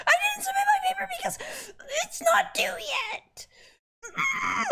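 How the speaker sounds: high, laughing through tears; laugh-crying. Real, not acted. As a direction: shout it out while half crying. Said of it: "I'm actually upset now".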